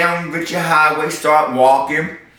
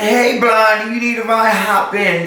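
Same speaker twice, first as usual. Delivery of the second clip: calling flirty driver; 9 semitones higher than usual. Retold or imitated. Man in truck calling to her.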